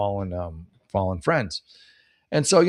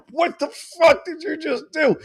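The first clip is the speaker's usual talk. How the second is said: shocked outburst. Retold or imitated. Comic replaying his shock at the MC's intro, high yelled disbelief. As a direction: blurt it out in stunned outrage. Stunned by MC's moment of silence.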